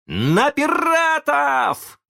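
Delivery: very high, slow rallying call; battle cry. A narrator's reading. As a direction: lead the charge. Peter leads charge. (Питер зовёт мальчишек в бой)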